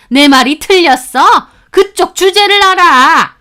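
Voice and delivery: loud, high, mocking rant, slowing on the last jabs; sneering shout. Mother mocking the orphan girlfriend, loud sneering rant. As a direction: spit out the insults with contempt.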